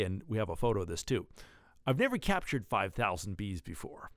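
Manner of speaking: ordinary talk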